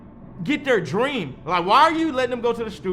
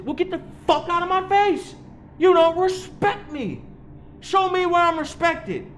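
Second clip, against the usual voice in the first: mocking whiny complainer; 10 semitones higher than usual. Mocking a touchy, prideful complainer.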